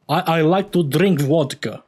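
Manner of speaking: slow, put-on Russian accent; mock Russian accent. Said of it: "It's Russian".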